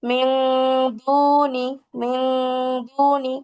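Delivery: মিন drawn out as a nasal "ming" before দুনি; ikhfa ghunna. Shown as a demonstration. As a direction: recite মিন দুনি slowly, humming the n. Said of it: "এখানেও ইকফা গুণনা হয়েছে".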